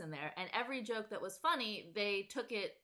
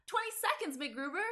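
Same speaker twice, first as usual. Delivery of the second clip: much higher, perky sketch voice; peppy Kristen Wiig voice; 8 semitones higher than usual. Retold or imitated. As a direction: pop the countdown up high and perky. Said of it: "the peppy Kristen Wiig voice".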